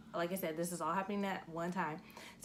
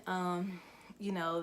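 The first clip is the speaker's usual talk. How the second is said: ordinary talk, nothing marked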